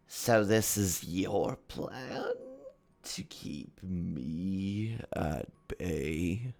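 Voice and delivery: very slow, drawn-out villain voice; Hat Man villain. Acted. Hat Man confronting the players' plan, slow villain voice. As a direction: stretch each word out like a looming villain.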